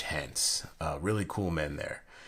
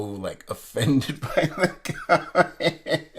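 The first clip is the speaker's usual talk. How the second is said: high, drawn-out words breaking into laughter; laughing; 8 semitones higher, 6 dB louder, much slower than usual. Real, not acted. Telling how offended the Marine was, laughing.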